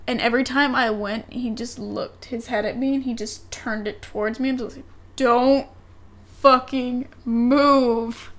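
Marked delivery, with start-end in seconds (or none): 5.2-8.3 each word dragged out very slowly; dragged-out hushed warning to the cousin; slow tense warning. Retold or imitated. Warning cousin, man behind him.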